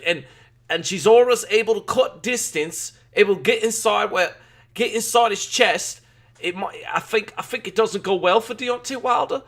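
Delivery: put-on British accent. Streamer granting chat's request with a hammed-up British accent. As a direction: ham it up like a London boxing pundit. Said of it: "do the British accent".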